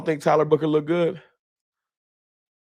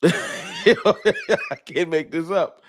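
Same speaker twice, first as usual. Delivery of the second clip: slow, higher words squeezed through laughter; disbelieving laugh; 6 semitones higher, much slower than usual. Real, not acted. Still laughing at Bills fan.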